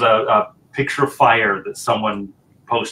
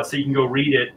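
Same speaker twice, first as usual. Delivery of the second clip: normal talking voice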